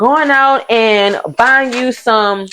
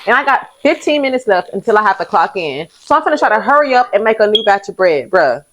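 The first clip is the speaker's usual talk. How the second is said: usual speaking voice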